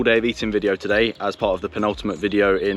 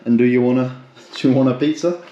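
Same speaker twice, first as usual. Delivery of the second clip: pet talk; 3 semitones higher, 4 dB louder than usual. Real, not acted. Offers pizza to the dog.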